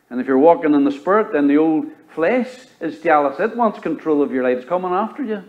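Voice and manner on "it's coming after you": ordinary talk, nothing marked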